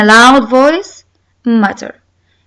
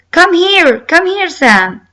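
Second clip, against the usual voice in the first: mock shout across the playground; 6 semitones higher than usual. Teacher acting Fred calling Sam across the playground, raised mock shout. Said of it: "Fred shouted".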